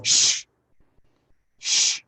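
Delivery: loud, sharp shush; imitated crowd shushing a noisy visitor. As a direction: shush someone firmly, twice. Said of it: "twenty people going".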